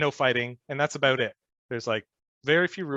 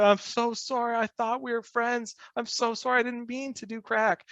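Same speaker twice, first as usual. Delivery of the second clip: much higher, mock sobbing plea; imitating bawling apology; 10 semitones higher than usual. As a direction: whine the apology like a sitcom crybaby. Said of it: "He just broke down bawling".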